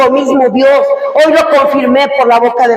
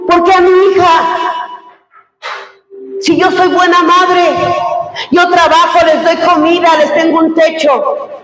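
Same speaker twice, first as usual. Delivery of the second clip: much higher, pleading complaint of a frustrated mother; queja frustrada; 6 semitones higher than usual. Retold or imitated. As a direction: cry out why me to the heavens, hurt. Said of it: "Y yo lloraba de frustración". (queja frustrada y aguda, casi llorando)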